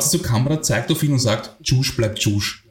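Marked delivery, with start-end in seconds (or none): 1.6-2.6 put-on slow, hinted Filipino accent on Viennese slang; hard Filipino accent. A small woman tells the Viennese man off, in a hint of a Filipino accent. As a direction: lightly imitate a Filipino woman's accent, drawn out. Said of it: "mit diesem harten philippinischen Akzent".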